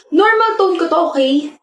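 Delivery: high-pitched, testy insistence. Acted. Friend defends own tone to friend, testy and high. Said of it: "Ang tawag mo dyan".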